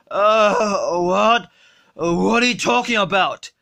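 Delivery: put-on low slurred drunk voice; sluggish, drunk and lazy; Homer Simpson.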